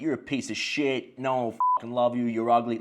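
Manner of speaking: usual speaking voice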